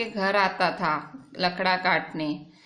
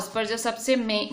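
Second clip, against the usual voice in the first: ordinary talk, nothing marked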